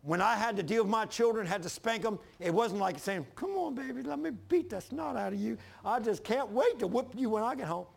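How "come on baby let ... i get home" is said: higher sing-song mock relish; mock eager father. Father mocks a parent eager to spank his children, gleeful. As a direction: taunt like a gleeful punisher. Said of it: "I'd be a perverted father".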